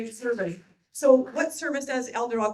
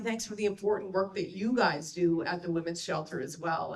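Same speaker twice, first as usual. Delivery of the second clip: normal talking voice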